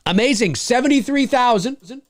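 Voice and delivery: high, thrilled tally announcement; game-show style big reveal; excited announcement. Real, not acted. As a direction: ring out the totals high. Announces new campaign totals.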